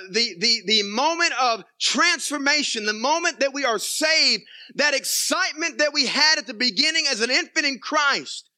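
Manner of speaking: high, loud, slowed preaching swell; passionate preaching. Real, not acted. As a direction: lift it up with fervor. Preacher rising on salvation.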